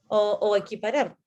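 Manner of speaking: usual speaking voice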